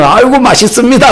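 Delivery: hammed-up polite praise; forced cheerful praise. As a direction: gush over food you hate. Pastor forcing praise.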